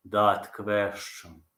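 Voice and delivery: very slow, low, drawn-out words; Prohaska impression. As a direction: stretch each word out, pitched low. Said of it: "I know Prohaska's impression really good".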